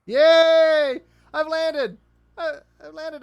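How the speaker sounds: high, loud cheer of relief; excited. Real, not acted. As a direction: celebrate the landing. Landing the lander successfully.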